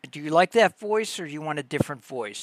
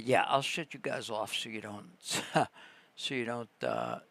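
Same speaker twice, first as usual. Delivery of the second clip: ordinary talk, nothing marked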